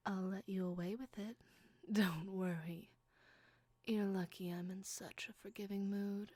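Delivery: ordinary delivery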